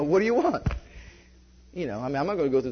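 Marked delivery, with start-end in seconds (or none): 0.0-0.7 exasperated retort. Retold or imitated. Confrontation with the IRS agent.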